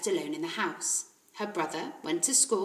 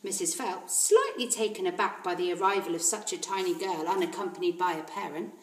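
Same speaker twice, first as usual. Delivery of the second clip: usual speaking voice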